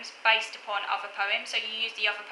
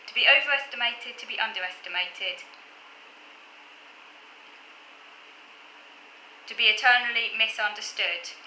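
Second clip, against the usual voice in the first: deliberate poem reading with long pauses; poem recitation. Acted. Poet rereading own crossed-out handwriting to viewers, halting poem recitation. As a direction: pick the verse back up, measured and halting.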